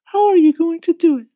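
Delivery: high sweet ladybug voice. A narrator's reading. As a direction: kind lady insect, very high and loud. Ladybug asks James.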